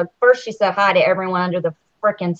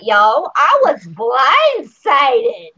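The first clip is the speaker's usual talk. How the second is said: drawn-out mock accent; hammed-up accent, slow and aggrieved; Rockstar; much slower than usual. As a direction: stretch it slowly in her put-on accent. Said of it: "it's the accent, y'all".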